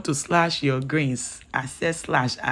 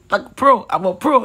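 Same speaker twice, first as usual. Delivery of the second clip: excited; 6 semitones higher, 7 dB louder than usual. Real, not acted. Boasting playfully.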